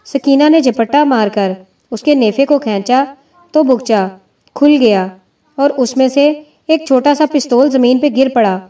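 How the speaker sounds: usual speaking voice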